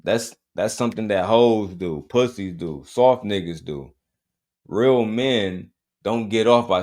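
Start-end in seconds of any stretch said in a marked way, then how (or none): none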